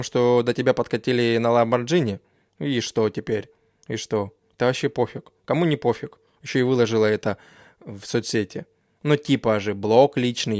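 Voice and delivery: ordinary talk, nothing marked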